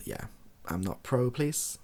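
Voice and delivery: ordinary talk, nothing marked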